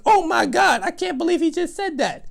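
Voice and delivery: high-pitched mock disbelief; high, hammed-up shock; imitated shocked ladies.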